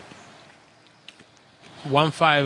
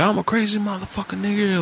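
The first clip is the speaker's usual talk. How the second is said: higher mock boast; mock crazy robber; 6 semitones higher, faster than usual. Retelling the robber's boast, higher. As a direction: puff up like the robber. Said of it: "like that".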